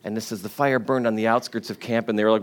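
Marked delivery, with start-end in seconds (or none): none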